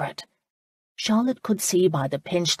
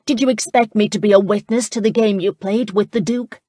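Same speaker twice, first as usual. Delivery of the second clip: louder, higher indignant demand; 4 semitones higher, 6 dB louder than usual. A narrator's reading. Charlotte confronts the laird about his motives, indignant and loud. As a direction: confront him, pitched up and pushing. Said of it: "she demanded".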